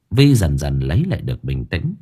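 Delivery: ordinary delivery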